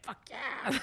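high, breathy whispered cheer; a hushed, giddy fist pump; mock-whispered triumph; thrilled. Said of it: "And I was like".

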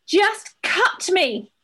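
high, drawn-out desperate cry; urgent shout. Acted. Host voicing a labouring mother's plea, urgent shout. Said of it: "I believe I urgently shouted".

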